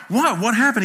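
high, loud, alarmed questions; jolted into asking, pitch jumping up; startled dad. Retold or imitated. Dad reacting to his son's sudden outcry, startled.

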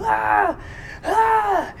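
very high mock shrieks; imitated child's wail. Father imitating daughter who won't stop, high wailing shrieks. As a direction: shriek like an upset little girl. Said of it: "she's just going".

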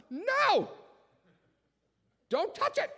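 put-on high shrieking kid's no; a toddler yelling at a helping parent; child screaming. Child screaming at parent not to touch, very high.

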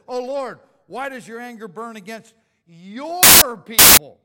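loud, high pleading call to the Lord; pleading cry. Retold or imitated. Preacher voicing Moses begging God, loud and pleading. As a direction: beg out loud, voice straining upward.

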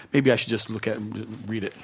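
ordinary delivery

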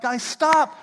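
loud, high parental shout; a parent yelling at squabbling kids; alarmed yell. Retold or imitated. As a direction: stop the kids before someone gets hurt. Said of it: "And I'm like".

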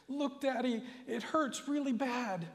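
put-on high, pained child's voice; little boy in pain. Little boy to his preacher father, high and pained. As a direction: play a small boy showing a hurt finger. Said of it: "an expression of suffering".

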